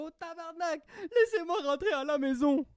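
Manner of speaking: put-on high, loud African accent, crying out; pleading in an African accent; African accent, mock cry. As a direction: wail it in an African accent like a shot game character. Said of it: "J'ai utilisé cet accent-là".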